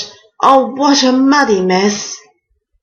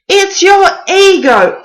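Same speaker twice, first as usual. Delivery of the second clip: higher, louder mocking jab; mocking scold; 10 semitones higher, 4 dB louder than usual. Tessa tells the king his ego did it, mocking. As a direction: needle him about his ego.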